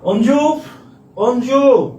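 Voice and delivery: high call with stretched last vowel. Shown as a demonstration. Teacher acts out calling a boy, high calling voice. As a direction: holler the name as if to someone away.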